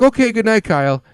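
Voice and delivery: lightly voiced kid bidding his dad good night; kid saying good night. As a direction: chirp it like a content toddler. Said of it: "And he was like".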